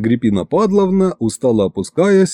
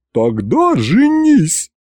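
roar; 8 semitones higher, much slower than usual. A narrator's reading. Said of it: "заревела Агриппина Падловна".

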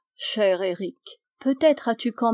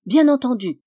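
louder, higher, unthinking quick agreement; réponse rapide; 4 semitones higher, 7 dB louder than usual. A narrator's reading. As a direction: agree at once, without thinking. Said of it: "répondis-je vite, sans réfléchir". (réponse vive, sans réfléchir)

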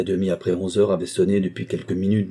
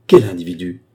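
a sudden loud cry of alarm; sharp loud exclamation; 2 semitones lower, 8 dB louder than usual. A narrator's reading. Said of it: "s'écria-t-il". (s'écrier fort, alarmé)

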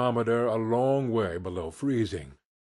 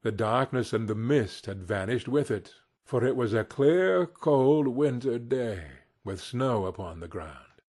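plain reading voice

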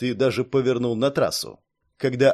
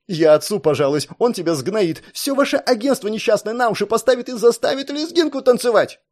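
angry threats; 8 semitones higher, 4 dB louder than usual. A narrator's reading. Captive girl threatening her guard.

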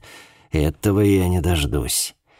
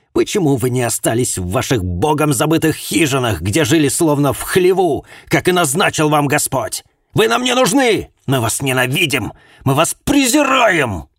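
hateful rant; 9 semitones higher, 5 dB louder than usual. Acted. Hateful mother rants at detective.

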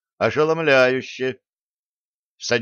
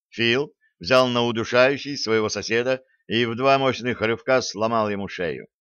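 plain reading voice

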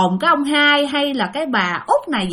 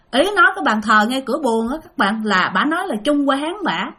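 normal talking voice

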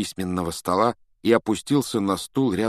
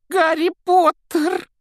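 very high, piercing elf exclamation; shrill house-elf cry; 8 dB louder, slower than usual. A narrator's reading. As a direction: squeal it out like a tiny elf. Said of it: "пронзительным голосом воскликнул".

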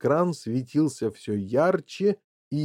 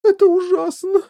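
very high, loud tearful wail; wailing sob; 10 dB louder than usual. A narrator's reading. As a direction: break into hammed-up sobbing. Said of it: "зарыдала миссис Ти Ви".

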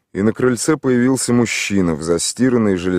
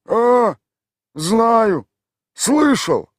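high, put-on village voice; gruff local man answering a stranger; rough villager; 5 dB louder, much slower than usual. A narrator's reading. Tolik answers the city stranger in a rough village voice.

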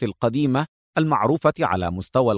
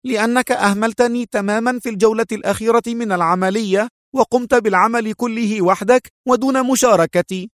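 high-pitched indignant complaint; resentful; 9 semitones higher than usual. A narrator's reading. Woman scolding the partner who left her out, high and resentful. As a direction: accuse him hotly in a high voice. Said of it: "لتقول في حنق".

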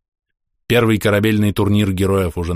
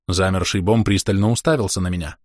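ordinary delivery

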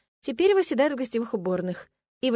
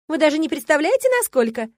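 louder, higher eager gush; excited girl; 6 semitones higher, 5 dB louder than usual. Acted. Girl in blue gushing to the bride, excited. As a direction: bubble over with excitement. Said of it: "возбуждённо проговорила девушка".

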